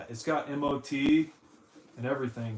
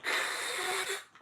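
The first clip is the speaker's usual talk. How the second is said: harsh unvoiced scrape imitation; imitated scraping noise. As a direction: mimic something scraped along pavement. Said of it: "took it on the pavement and went".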